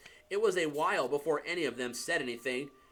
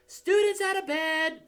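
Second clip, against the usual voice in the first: much higher, louder bellow; 6 dB louder than usual. Acted. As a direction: raise the alarm at full voice. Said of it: "Peeves bellowed".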